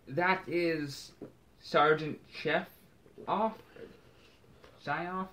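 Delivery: put-on German-accented officer calling out names. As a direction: voice the legion sergeant with a German accent. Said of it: "that's a German accent".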